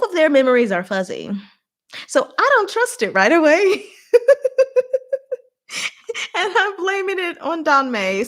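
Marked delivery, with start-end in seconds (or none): 2.1-3.9 higher and louder, laughing through the words; laughing suspicion. Real, not acted. Laughing at her own suspicion. 6.3-8.2 higher, laughing through the line; laughing admission. Real, not acted. Laughing at her own bias.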